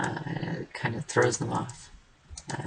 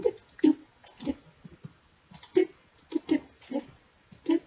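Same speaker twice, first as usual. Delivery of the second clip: playful sound effects; much slower than usual. Pretend. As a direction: make silly cartoon sounds while building. More silly sound effects.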